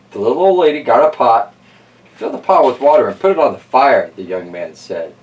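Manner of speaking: lively read-aloud storyteller voice; story narration. Acted. Host reading Stone Soup to kids, storytelling voice. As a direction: tell it like a bedtime picture book. Reads picture book aloud.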